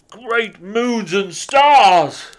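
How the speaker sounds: slow loud Santa sigh. A narrator's reading. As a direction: exclaim like a weary Father Christmas. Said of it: "sighed Santa".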